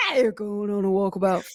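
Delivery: jokey put-on Aussie twang; mock Australian accent. Riffing with co-host on walkabout, jokey mock Australian accent. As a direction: keep up the Australian impression. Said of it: "talking in Australian accents nonstop".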